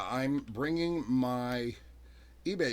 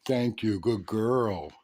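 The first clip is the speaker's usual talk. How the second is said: loud, happy praise for the puppy; praising a dog; 7 dB louder, slower than usual. Real, not acted. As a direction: cheer the dog on.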